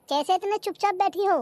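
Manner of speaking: ordinary delivery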